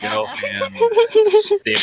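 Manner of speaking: laughing, drawn-out name; chuckling it out slowly. Real, not acted. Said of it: "Johann Bach".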